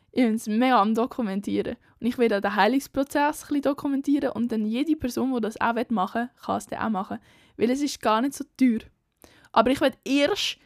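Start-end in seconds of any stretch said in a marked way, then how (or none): none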